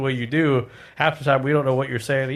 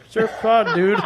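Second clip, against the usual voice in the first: lightly voiced laid-back surfer; a hint of California surfer dude; 6 semitones higher, slower than usual. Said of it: "talking like a surfer".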